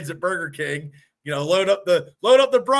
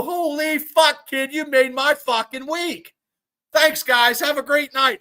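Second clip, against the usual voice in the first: higher and slow, loud on "Holy fuck", bright thanks to close; delighted exclamation; 7 semitones higher, 4 dB louder, slower than usual. Retold or imitated. Man thanks the kids who took him for a teen, thrilled. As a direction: savor the compliment out loud. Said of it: "I go".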